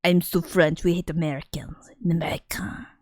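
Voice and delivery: really bad fake French accent; hammed-up French accent; exaggerated French accent. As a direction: play a pouting French snob, accent overdone. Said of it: "the really bad one that's like".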